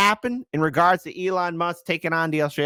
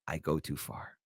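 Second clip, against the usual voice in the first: hushed repeat; 12 semitones lower, 15 dB quieter than usual. Real, not acted.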